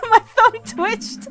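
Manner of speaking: slow, high, loud laughing wail; laughing in disbelief. Real, not acted. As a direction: laugh the words out, drawn long. Laughing at own failure.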